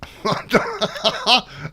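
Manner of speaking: high amused giggle. Real, not acted. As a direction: giggle at the silliness. Laughs at the mod.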